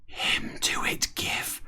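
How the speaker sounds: breathy whispered line, slow; whispered aside for Starbuck. Acted. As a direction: hush it right down to a whisper. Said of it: "Starbuck whispered".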